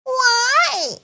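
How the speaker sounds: high, small piglet voice; little George. Acted. George asks why he must stay in bed, high little voice. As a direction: ask it like a tiny child. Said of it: "asks George".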